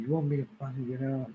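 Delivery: high, fast incredulous mock yell; retold angry retort. Answering neighbors who told him to leave, mock yell.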